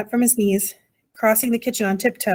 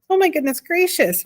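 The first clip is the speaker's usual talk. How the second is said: high-pitched moan of dismay; narrator's acted high wail; 8 semitones higher than usual. A narrator's reading. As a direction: moan it high, quite overcome. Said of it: "moaned Homily again".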